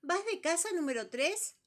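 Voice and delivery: high, loud startled squeak; frightened question. Acted. Quiz bird asking number three in a fright. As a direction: ask it in a fright, voice jumping up. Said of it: "le preguntó muy asustado".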